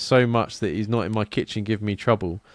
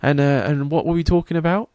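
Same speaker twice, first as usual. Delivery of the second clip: higher-pitched switch into proper English; proper English accent; 5 semitones higher than usual. Retold or imitated. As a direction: put on polite, proper English. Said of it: "proper English".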